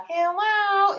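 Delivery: singsong, long-held exclamation; emphatic hello. Real, not acted. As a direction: stretch it out high, like it's obvious. Emphatic plug for the drink.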